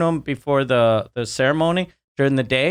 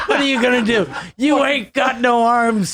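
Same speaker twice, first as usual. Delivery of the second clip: mocking taunt; 9 semitones higher than usual. Taunting the Amazon trees.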